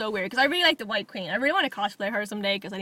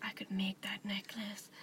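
voiceless whisper, slow; 4 semitones lower, 15 dB quieter, slower than usual. Retold or imitated. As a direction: whisper it to yourself slowly. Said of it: "I was looking at it going".

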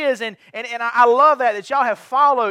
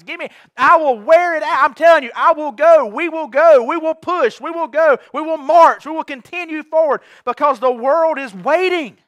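louder and higher, pounding each short vow; rally the congregation, voice raised on every promise; rousing preaching climax; 4 semitones higher, 6 dB louder than usual. Acted.

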